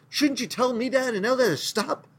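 a worried aide urging his master; anxious Joshua. Retold or imitated. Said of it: "And Joshua's going".

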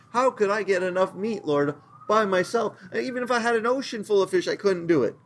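high, complaining overwhelmed voice; whiny Moses. Retold or imitated. Preacher playing Moses complaining to the Lord he can't feed them. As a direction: whine it out, the fish line hurried.

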